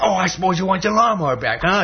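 high, loud cartoon outrage; fuming mad. Fuming neighbour answering the door to Pink Panther, mad. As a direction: throw it at him, hammed-up fury. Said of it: "now Harry's all fuming mad".

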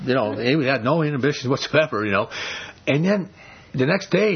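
ordinary talk, nothing marked

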